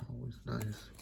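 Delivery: soft whispered aside; whispering. Real, not acted. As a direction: whisper it to yourself. Quiet aside while opening cards.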